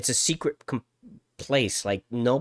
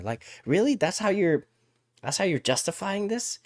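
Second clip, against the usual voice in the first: incredulous; 8 semitones higher than usual. Real, not acted. Exasperated rant.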